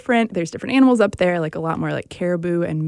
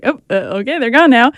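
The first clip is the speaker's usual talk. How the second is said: louder, higher mock-surprised realization; sudden realization; 4 semitones higher, 9 dB louder, faster than usual. Watching the mushers all run away, suddenly surprised. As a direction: react as if caught off guard, playfully.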